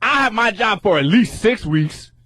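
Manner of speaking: high, slow mock-defiant voice; imitating defiant rep. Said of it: "She goes, she goes".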